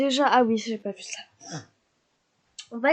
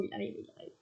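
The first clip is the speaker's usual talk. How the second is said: whispered, much softer; excited whisper; 4 semitones lower, 15 dB quieter, much faster than usual. Real, not acted. Streamer spotting the neighbour arriving, whispered excitement. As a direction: hush it as if not to scare him.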